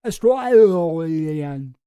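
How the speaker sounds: "Australian" drawn out very slowly in an Australian accent, loud; mock Australian twang on "Australian"; exaggerated Australian accent. Shown as a demonstration. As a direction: stretch "Australian" like a broad Aussie. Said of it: "when I try to speak Australian".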